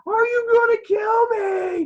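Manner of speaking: put-on slow, high, loud child's scream; screaming in terror. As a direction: scream it at him, panicked. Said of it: "I'm gonna start screaming at him".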